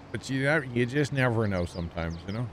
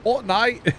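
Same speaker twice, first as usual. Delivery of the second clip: very high, loud mock British accent; over-the-top British imitation; 12 semitones higher, 5 dB louder than usual. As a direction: squeal it like a hammed-up Brit. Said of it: "why do you say Fortnite like a British person".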